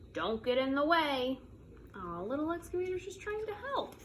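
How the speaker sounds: usual speaking voice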